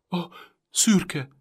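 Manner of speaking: high, loud stammer on the edge of crying; a boy blurting out his lost horse; stammering, near tears. A narrator's reading. Little boy stammering about his horse, close to crying. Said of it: "hebegte a fiú sírásra torzult szájjal".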